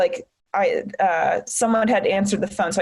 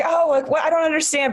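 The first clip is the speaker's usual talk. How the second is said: higher-pitched, defensive bewilderment; retold feeling attacked; 7 semitones higher than usual. Recalling how she felt attacked back then, mock defensive confusion. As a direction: imitate being taken aback, pitch up. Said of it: "at the time I felt attacked".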